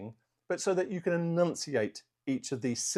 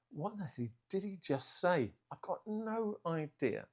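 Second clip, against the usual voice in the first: high, hushed voice of a confused listener; baffled viewer; 5 semitones higher, 7 dB quieter than usual. Acted. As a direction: play a bewildered viewer, higher and much softer. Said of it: "rewind and say".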